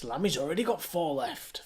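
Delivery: ordinary talk, nothing marked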